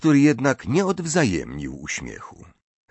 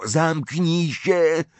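roared; 8 semitones higher, much slower than usual. A narrator's reading. Ron cuts off his sister's taunt, roaring. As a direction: cut her off with a slow roar. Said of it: "ryknął Ron".

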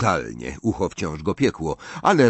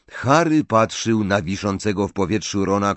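usual speaking voice

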